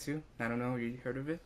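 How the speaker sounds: usual speaking voice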